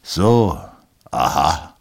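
put-on husband plays the drunk to his wife, loud; strange drunken voice. A narrator's reading. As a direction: sing it out like a drunk. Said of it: "mit einer fremden Stimme".

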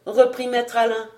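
plain reading voice

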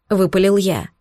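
ordinary delivery

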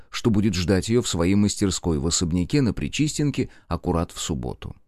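ordinary delivery, nothing marked

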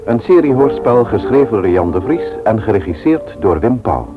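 normal talking voice

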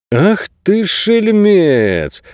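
high, drawn-out amazed cry; delighted exclamation. A narrator's reading. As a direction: exclaim slowly in admiring surprise. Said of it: "воскликнул Найрод". (протяжное изумлённое восклицание)

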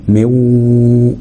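مو drawn out through the nose; waw with ghunna. Shown as a demonstration. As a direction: hold مو as a long nasal w. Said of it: "والغنة بتاعتهم".

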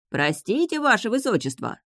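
high call from the ship's deck; shout. A narrator's reading. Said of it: "крикнул с палубы посол Котлии". (крик с палубы)

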